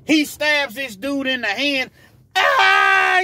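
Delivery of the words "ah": high loud pained cry; retold scream. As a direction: let out a short pained scream. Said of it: "he's screaming".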